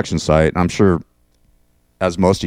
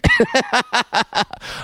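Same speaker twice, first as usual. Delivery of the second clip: mocking laugh. Real, not acted. Laughing at the name.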